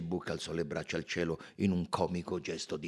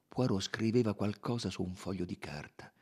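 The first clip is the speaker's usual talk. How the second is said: ordinary delivery, nothing marked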